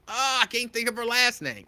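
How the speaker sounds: ordinary talk, nothing marked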